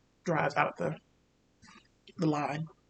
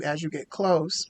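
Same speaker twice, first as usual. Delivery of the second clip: normal talking voice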